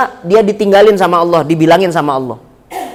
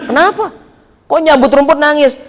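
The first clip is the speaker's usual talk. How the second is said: high-pitched incredulous question; baffled robber; 8 semitones higher than usual. Retold or imitated. Robber asks why pulling grass makes one cry, baffled. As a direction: pitch jumps up in acted puzzlement. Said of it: "kata Sunan Kalijaga".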